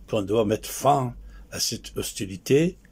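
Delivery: ordinary talk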